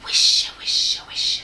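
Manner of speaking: breathy unvoiced whisper of trees. A narrator's reading. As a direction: whisper it like rustling leaves. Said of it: "said the trees nearby".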